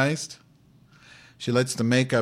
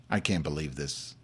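ordinary talk

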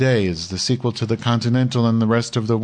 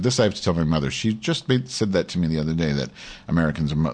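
usual speaking voice

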